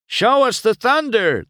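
high, loud plea; begging. A narrator's reading. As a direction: clamour like eager reporters. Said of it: "the reporters begged".

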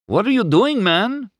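ordinary delivery

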